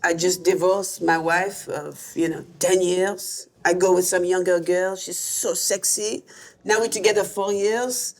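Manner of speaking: slow, lightly put-on French accent; a hint of a weary French director. Comic retelling the French director's advice in a slow French accent. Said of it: "says something in a French accent".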